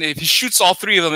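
ordinary delivery

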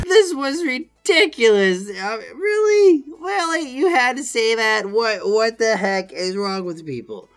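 high, incredulous rant; exasperated outburst. Real, not acted. Worker venting disbelief at the customer, high and exasperated. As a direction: throw up your hands in disbelief.

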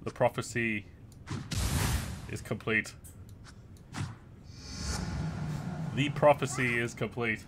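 mock epic announcer. Dad hamming up a prophecy about the four boys.

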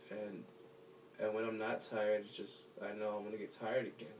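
ordinary talk

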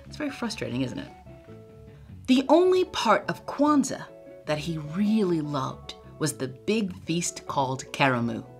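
ordinary delivery

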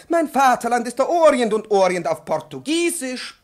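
high, loud proclamation; loud declaiming. Acted. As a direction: announce it with fervour. Professor declaims loudly.

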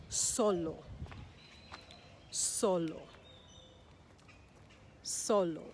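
Solo with strong SO, falling lo; repeated model "Solo", first syllable stressed. Shown as a demonstration. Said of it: "that's where we put the emphasis".

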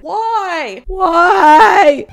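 loud despairing why; exasperated shout. Real, not acted. Vlogger shouting at the clip, exasperated. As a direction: throw up your hands and yell it.

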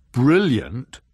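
loud, scornful rising question; snorted disbelief. A narrator's reading. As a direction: snort the word BRILLIANT back at the boaster. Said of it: "snorted the bear".

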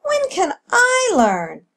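put-on high, drawn-out child's plea; eager youngster begging mother; pleading cry. A narrator's reading. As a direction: whine it upward, stretching the words. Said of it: "cried Echo".

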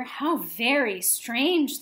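ordinary delivery

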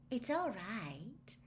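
slow, far-off dreamy murmur. A narrator's reading. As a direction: drift through it, lost in thought. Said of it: "said a dreamy voice".

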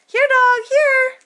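high, loud call; calling the dog. Acted. Daughter calling the dog to help, high and loud.

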